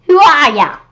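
put-on high, loud old lady yell. A narrator's reading. Old lady yelling at the monster, loud and high. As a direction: holler at the intruder. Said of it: "Miss Young Christie yelled".

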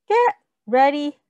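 normal talking voice

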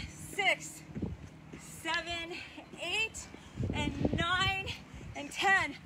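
spaced-out count alternating rising and falling; workout rep counting. Real, not acted. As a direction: call out the reps like a coach, pushing through the effort. Counts out exercise reps.

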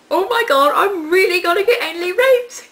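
high, loud mock panic; freaking out. Vlogger retelling inner alarm on the train, freaking out. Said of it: "freaking out in my mind".

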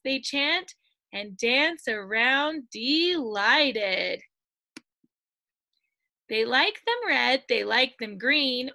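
lively, sing-song storybook voice; bouncy read-aloud storytelling; reading to children. Acted. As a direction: read it out to a class of little ones. Reads picture book to children.

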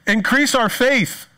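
pleading apostles. Retold or imitated.

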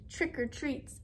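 ordinary delivery, nothing marked

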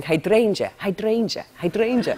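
lilting Welsh "Hydrangea", repeated; Welsh accent. Retold or imitated. Guest imitates actress walking round set, Welsh accent. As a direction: practise Welsh on one word. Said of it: "she had to be Welsh".

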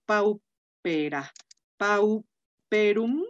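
paupera, pauperum drawn out slowly, each syllable clear; slow Latin pronunciation. Shown as a demonstration. Teacher slowly dictating Latin adjective endings to students. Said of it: "aquí pauper".

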